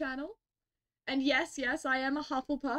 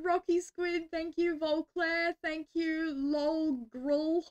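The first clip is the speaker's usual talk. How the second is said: ordinary talk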